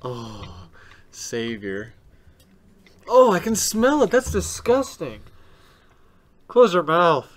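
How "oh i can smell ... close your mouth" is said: high, loud grossed-out cries. Real, not acted. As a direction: react to a nasty smell. Smelling toothpaste breath.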